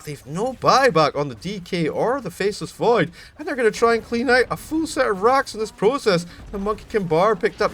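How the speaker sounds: high, loud, excited esports casting; live play-by-play. Acted. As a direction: call the teamfight at full hype.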